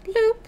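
squeaky high bloop; high bloop sound. Pretend. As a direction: pop out a cartoon bloop. Imitating a game sound.

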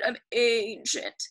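ANCIENT drawn out in a put-on old voice; slow, playful imitation of someone very old; old-sounding voice. Shown as a demonstration. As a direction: stretch ANCIENT as if aged yourself. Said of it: "It makes me sound kind of old".

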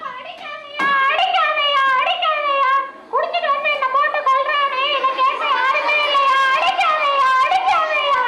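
high wailing plea, begging him to stop; pleading cry. Acted. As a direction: cry out in panic as the blows fall. Wife begs husband not to hit.

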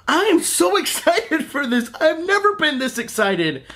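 high, laughing burst of excitement; giddy excitement. Real, not acted. Fan gushing to viewers about the movie, giddy and laughing. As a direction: squeal it out, laughing with glee.

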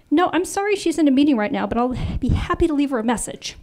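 sweet, eager telephone manner, pitched high; cheerful receptionist. Acted. Answering boss's phone as receptionist.

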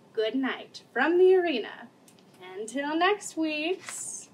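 bedtime story read aloud; storybook read. A narrator's reading. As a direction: read it warmly to the kids. Reading wrestling picture book.

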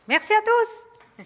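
high, slow, loud cheer; excited thanks. Real, not acted. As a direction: sing it out to the room. Cheerful sign-off to audience.

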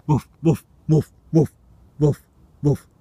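loud, high playful woof woof; barking like a dog. Pretend. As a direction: bark at the worms like a dog. Barks to scare worms.

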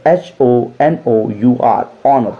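usual speaking voice

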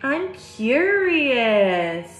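lifted, sing-song "Look at me"; picture book read-aloud; storybook refrain. A narrator's reading. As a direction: read the refrain brightly for kids.

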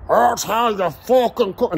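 dad bragging about his grilling, mocked; mocking dad. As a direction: drag it out like a know-it-all dad. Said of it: "He likes".

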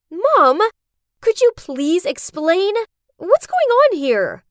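high, loud, alarmed demand for answers; screamed demand. A narrator's reading. Daughter confronts mom over the locked-in girl, screamed demand. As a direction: cry out to a mother in disbelief. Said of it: "I screamed".

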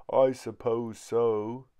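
slow sleepy words drawn out by a yawn; sleepy child yawning the answer. Acted. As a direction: yawn through the reply. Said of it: "yawned Chip".

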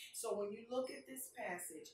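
ordinary talk, nothing marked

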